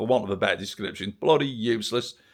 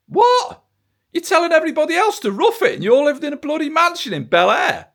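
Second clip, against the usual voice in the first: outraged disbelief; 5 dB louder than usual. Real, not acted. Outraged at mansion hypocrisy.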